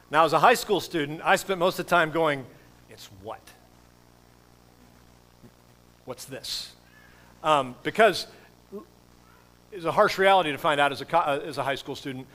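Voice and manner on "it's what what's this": low, hushed puzzled questions; quietly baffled. Retold or imitated. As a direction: ask it under your breath, lost. Acting his baffled teenage self.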